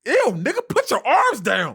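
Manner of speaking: high, loud grossed-out shout; recoil, loud and high; disgusted. Real, not acted. Reacting to friend stretching.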